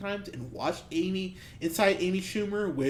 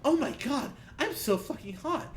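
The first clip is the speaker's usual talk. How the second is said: higher, mocking breathy-girl parody; mocking the heroine's sudden vanity, pitched up; mock vain heroine; 9 semitones higher, 5 dB quieter than usual. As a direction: sneer as the self-admiring heroine, pitched up. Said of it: "and goes".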